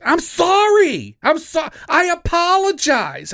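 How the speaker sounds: high, slow whiny mock voice; mock apology. Mocking the woman caught leaving her cart, high and slow. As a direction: mimic her sorry in a squeaky drawn-out voice.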